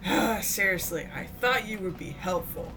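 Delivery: normal talking voice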